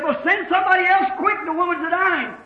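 high, quick retold plea; urgent call. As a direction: act out a farmer hurrying for help.

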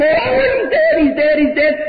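hammed-up mother's despairing scream; retold scream. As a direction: cry it out like a frantic mother. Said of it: "my mother again screamed".

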